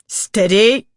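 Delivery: calm him with force; warning shout. A narrator's reading. Narrator warning panicking Crompton, loud warning.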